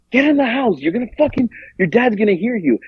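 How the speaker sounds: anxious nagging, lightly acted; urgent retold warning. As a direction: imitate a worried friend hurrying someone inside. Worried friend hurrying her inside.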